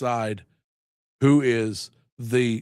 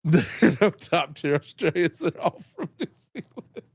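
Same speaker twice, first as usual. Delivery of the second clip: laughing; 4 semitones higher than usual. Real, not acted. Joking, laughing.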